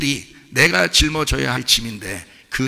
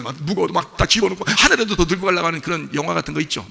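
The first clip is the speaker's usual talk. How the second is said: ordinary talk, nothing marked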